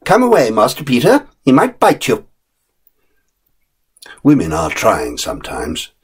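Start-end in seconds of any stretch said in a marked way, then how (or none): 0.1-2.3 sharp nurse. Acted. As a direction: correct the boy primly. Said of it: "said the nurse".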